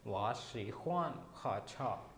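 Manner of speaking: slow, careful Mandarin sentence; slow Chinese demo. Shown as a demonstration. Teacher to students: slow Mandarin model sentence. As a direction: spell out each Mandarin syllable. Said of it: "अगर personally देखा जाए तो I like".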